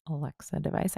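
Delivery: soft, dropped to a hush; whispering. Real, not acted. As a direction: hush the name so it won't wake. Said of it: "I have to whisper that".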